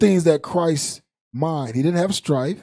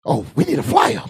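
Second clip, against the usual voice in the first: church sister voice; 7 semitones higher, 7 dB louder than usual. Retold or imitated. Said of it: "sisters say".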